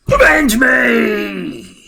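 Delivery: hammed-up dying cry, very slow; overacted death scene. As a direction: scream it like a dying villain. Green Goblin cover, dying cry.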